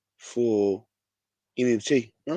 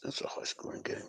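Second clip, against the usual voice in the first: whispered aside; 15 dB quieter than usual. Real, not acted. Muttered aside over scores.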